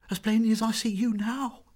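put-on high, loud, insistent girl's voice; frightened insistence. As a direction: insist it in alarm.

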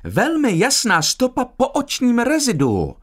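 high, theatrical announcement; feigned excitement. A narrator's reading. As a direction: proclaim it in put-on excitement, pitched high. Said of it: "vykřikla s hraným vzrušením". (s hraným vzrušením)

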